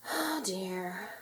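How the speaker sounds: soft, sighing disappointment. A narrator's reading. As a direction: let it out on a sigh. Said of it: "Ariel sighed".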